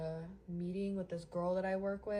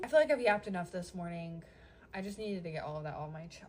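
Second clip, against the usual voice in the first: ordinary talk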